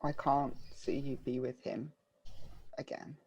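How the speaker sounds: soft, hurting confession; quiet plea. Acted. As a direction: say it low and wounded. Character quiet emotional plea.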